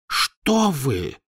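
horrified. A narrator's reading. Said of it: "ужаснувшись".

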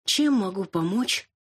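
тягуче и высоко, скучающая служащая; протяни скучно, без интереса; меланхолично. Acted. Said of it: "меланхолично спросила она". (меланхоличный голос администратора)

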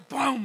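loud, drawn-out "pum"; imitated impact bang. Car drops into the pothole, loud imitated bang. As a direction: boom out the crash noise.

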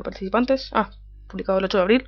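ordinary talk

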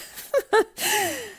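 high scornful laugh at the idea of love; scoffing laugh. Acted. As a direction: laugh it off in disbelief.

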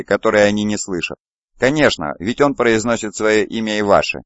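ordinary delivery

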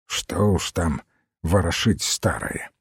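put-on low, quiet grumble of an old man; gruff grumble. A narrator's reading. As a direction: grumble it under your breath, brushing it off. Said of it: "отрывисто буркнул он". (тихое отрывистое ворчание)

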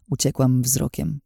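ordinary delivery, nothing marked

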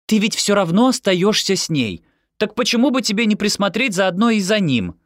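high, loud voice of a breezy mother; pushy Dekla. Acted. Dekla talks Yoni into babysitting, pushy and high.

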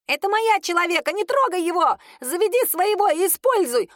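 high, shrill-pitched imp screech; angry little imp. A narrator's reading. Little imp defends its human.

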